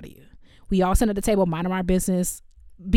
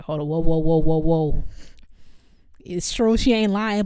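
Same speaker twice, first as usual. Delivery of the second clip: mock angel interrupting; 3 semitones lower, 5 dB louder than usual. Angel stops Joseph's divorce.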